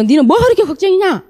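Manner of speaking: high-pitched retold scolding of a sister; mock scolding. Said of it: "내가 막 호통을 치는 거예요".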